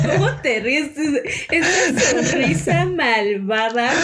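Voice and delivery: laughing while talking. Real, not acted.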